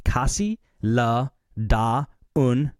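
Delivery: "Casi la da un" said very slowly, each syllable apart; slow foreign read. Shown as a demonstration. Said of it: "it's C A S I".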